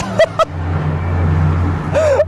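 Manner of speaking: breathy high burst of laughter; airy giggling laugh. Real, not acted. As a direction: crack up at the message. Laughing at donation message.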